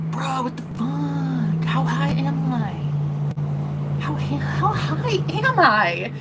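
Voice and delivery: slow, dazed and high, drawing it out; like you can't believe how high you are; stoned disbelief. Retold or imitated. Telling friends about being high, slow dazed disbelief.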